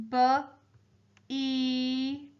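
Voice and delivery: "B, E" as b, pause, long ee; sounding out letters. Shown as a demonstration. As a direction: spell "B, E" by sound, spaced out. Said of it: "help me spell out the word".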